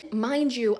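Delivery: ordinary delivery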